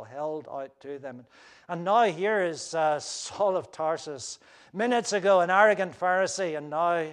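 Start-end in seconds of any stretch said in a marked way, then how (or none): none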